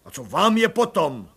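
loud gruff bark; barked retort. Acted. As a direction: bark him off. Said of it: "vyštěkl plukovník".

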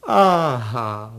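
loud, delighted exclamation; joyful cry. A narrator's reading. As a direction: cry out in happy discovery. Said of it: "vykřikl pan Kolbaba radostně".

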